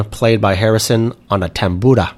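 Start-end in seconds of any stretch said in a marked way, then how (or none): 1.3-2.1 tambura with a Spanish-style rolled r; Spanish accent. Real, not acted. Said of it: "I said it with a Spanish accent".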